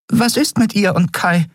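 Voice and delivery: high-pitched little creature voice; Nelen, anxious. Acted. (besorgte, hohe Figurenstimme)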